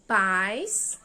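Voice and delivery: "paz" alto e esticado, isolado; primeira sílaba isolada. Shown as a demonstration. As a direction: say "paz" loud and drawn out, as one separate syllable. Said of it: "Então, vai ficar". ("paz" como sílaba separada, bem forte)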